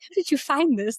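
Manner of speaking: high, amazed retold question. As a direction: toss it back in disbelief. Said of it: "I was like".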